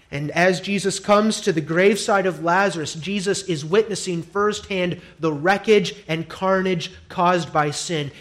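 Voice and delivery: ordinary talk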